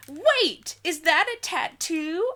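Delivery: normal talking voice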